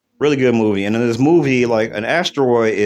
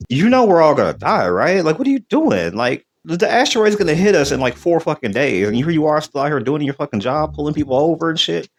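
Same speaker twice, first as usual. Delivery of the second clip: incredulous outburst; 5 semitones higher than usual. Retold or imitated. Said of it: "but he was just like".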